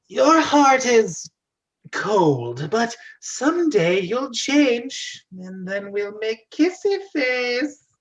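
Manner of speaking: slow, high, loud crone prophecy; old hag promising Mario a future kiss. Acted. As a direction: foretell it like a mystical hag.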